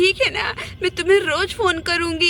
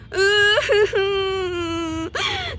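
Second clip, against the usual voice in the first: crying wail. Acted. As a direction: burst into a wailing sob. Sister-in-law wails on arrival. (छोटी भाभी का सिमरन को देखकर ज़ोर से रोना)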